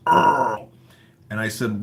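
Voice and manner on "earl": imitating [name]. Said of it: "And he goes".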